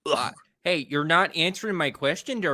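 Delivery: folksy Wisconsin local, prodding; Wisconsin accent. Acted. Local wanting his question answered, Wisconsin accent. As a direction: press them in a Wisconsin lilt. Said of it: "with that accent".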